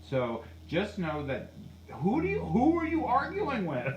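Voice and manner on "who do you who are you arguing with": high-pitched, baffled question; incredulous challenge. Acted. Medium asking who the driver is arguing with, incredulous challenge. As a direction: send it way up in disbelief.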